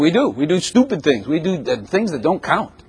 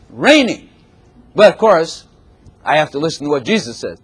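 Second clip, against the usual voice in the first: ordinary talk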